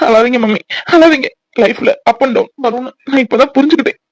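weepy, choked-up confession; tearful son. Acted. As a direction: apologise to mother through sobs. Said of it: "உன்னை பார்த்தா அவனும் அழுறானா?".

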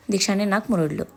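usual speaking voice

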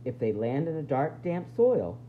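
ordinary talk

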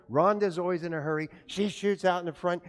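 ordinary talk, nothing marked

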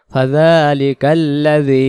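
drawn-out Quran reading; slow recitation. Shown as a demonstration. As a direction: chant it slowly syllable by syllable. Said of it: "recites the joined word slowly". (ধীরে তিলাওয়াত)

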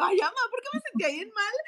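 high, fast, whiny; whiny to mom. Retold or imitated. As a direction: whine it to mom. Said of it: "Y le digo". (a su mamá, quejumbrosa)

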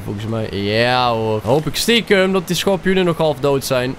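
ordinary talk, nothing marked

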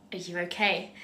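hushed, low check-in; quiet, careful imitation of sympathy; gentle concern. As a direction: ask softly as a concerned manager. Manager checks on upset teenager.

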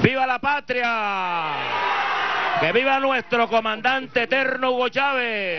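shouted rally chant; rousing cheers, full voice; ranting dictator speech. Real, not acted. (consigna gritada)

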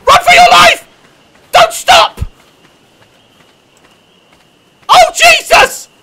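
high, loud frantic shouts; panicked yelling. Real, not acted. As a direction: yell for your life. Fleeing dinosaur.

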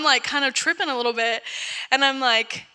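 normal talking voice

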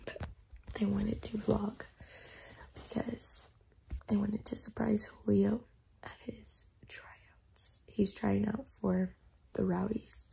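soft, hushed morning voice with little voice in it; whispering. Real, not acted. Vlogger just woken up with no voice, whispering. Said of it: "I'm, like, whispering".

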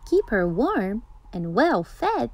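ordinary delivery, nothing marked

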